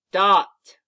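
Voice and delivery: "dot" as one short, loud word; said fast. Shown as a demonstration. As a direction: punch out "dot" without splitting it. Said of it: "Say it again".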